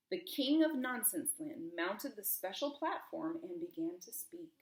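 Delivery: ordinary delivery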